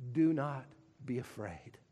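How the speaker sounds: soft, low, tender promise; gentle reassurance. Acted. God softly reassuring Paul in the night, tender and low. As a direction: hush it like comfort in the night. God's comforting words to Paul.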